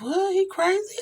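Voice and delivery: high, stunned "What? He crazy?"; incredulous squeak; shocked disbelief. Retold or imitated. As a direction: react like you can't believe it. Shocked reaction to mom's news.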